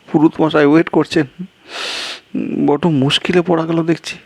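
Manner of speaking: a flustered man fearing trouble; worried guest. Acted.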